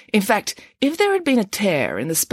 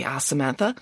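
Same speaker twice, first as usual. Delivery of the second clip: ordinary delivery, nothing marked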